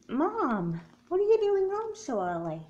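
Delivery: startled surprise. Acted. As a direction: caught off guard, voice jumps. Surprised mom is home early.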